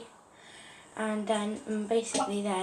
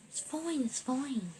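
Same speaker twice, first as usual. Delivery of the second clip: half-whispered, softer voice; hushed reassurance; 3 semitones higher, 5 dB quieter, slower than usual. Retold or imitated. Friend reassures cousin about the lighter, half-whispered. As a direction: brush it off under your breath.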